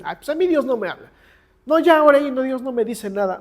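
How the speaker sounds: mock whiny complaint. Mocking those who say God is silent.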